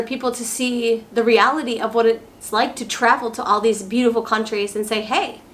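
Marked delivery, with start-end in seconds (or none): none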